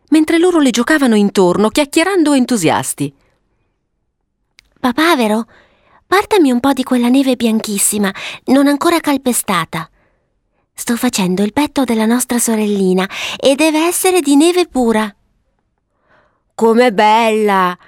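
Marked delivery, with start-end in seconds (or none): 4.8-15.1 put-on high girlish voice, in charge; little girl Violetta. Violetta giving orders while building the snow sister, high child voice. 16.5-17.7 put-on drawn-out childlike wonder; slow, marvelling little-boy cry; admiring exclamation. Papavero admiring the snow girl, slow delighted exclamation. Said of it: "esclamò Papavero".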